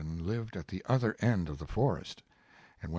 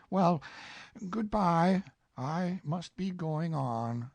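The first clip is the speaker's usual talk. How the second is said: lightly voiced Winnie-the-Pooh, polite; 10 semitones higher, 4 dB louder, slower than usual.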